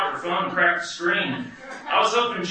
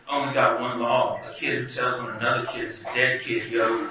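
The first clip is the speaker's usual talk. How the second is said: put-on tough teen; 6 semitones lower than usual. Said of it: "imagining himself in Over the Edge".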